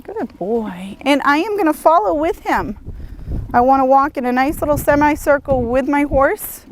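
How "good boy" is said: soft praise. Real, not acted. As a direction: soothe the horse quietly. Praising horse during lunging.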